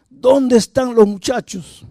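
pregunta lenta, muy aguda e insistente; exigencia furiosa actuada. Retold or imitated. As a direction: imita a un padre furioso, marcando despacio cada palabra. Repite la exigencia con más fuerza. (exigir alzando mucho el tono, despacio)

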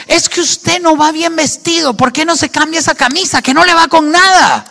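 high, rising mock scolding; nagging scolding. Wife criticizing husband's outfit before going out, high-pitched mock scolding.

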